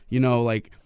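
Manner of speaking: ordinary talk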